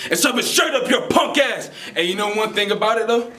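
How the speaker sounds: high, punched defiant yell; defiant shout. Acted. Performer telling off the doubters, defiant shout. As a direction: shout it right in their face. Spoken-word piece builds to defiant climax.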